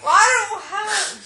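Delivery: shouting through laughter; laughing shout. Real, not acted. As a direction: laugh and yell it slowly. Laughing while feeding the baby.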